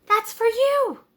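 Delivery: put-on sweet kid handing over a gift; high child offering a treat; little boy voice. Tommy giving apple to horse.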